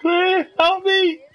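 high, frantic yelp for help; panicked cry for help. Real, not acted. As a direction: squeal like you're being chased. Chased in game, calling for help.

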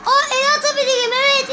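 high, eager surprise; thrilled to find a new move; excited discovery. Real, not acted. Gamer discovers he can grab things, excited.

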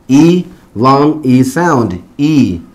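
E, long E sound, slow and drawn out. Shown as a demonstration. Teacher naming the final Y sound, slow long E. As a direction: stretch each E into a long 'ee'. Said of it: "va a sonar como E".

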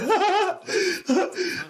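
giddy high laughter; high excited laugh. Real, not acted. Streamer at eight thousand dollars, giddy laugh. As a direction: laugh high in disbelief at the money.

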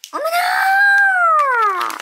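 a long, very high, loud "oh my!" at the toy; surprised shriek. Real, not acted. Unboxing host shrieking at the toy, surprised. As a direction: gasp it out like the surprise of the year.

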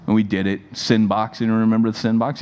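ordinary talk

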